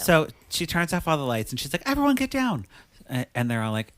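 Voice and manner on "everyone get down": very high, excited mock command; hammed-up party-host urgency; urgent retold order. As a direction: call the room to hide, pitched way up. Retold order before surprise party.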